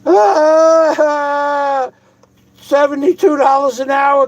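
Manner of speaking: comic Airplane movie shout; Lloyd Bridges in Airplane. Retold or imitated. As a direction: shout it high like the Airplane bit. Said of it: "like the Lloyd Bridges".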